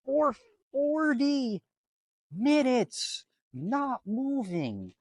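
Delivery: fed-up traveler ranting about sitting on the runway; exasperated rant. Real, not acted. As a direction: vent like you can't believe it. Venting about the runway delay.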